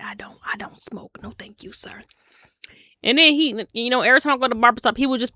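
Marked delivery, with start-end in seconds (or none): 0.0-2.0 unvoiced hushed refusal; timid whispered no thank you. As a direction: whisper it so no one hears.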